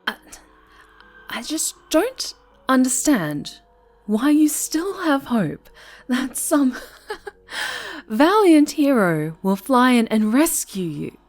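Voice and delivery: high, loud mocking laughter; manic laughing outburst. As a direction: laugh at their foolish hope. Villain mocks the captive's hope.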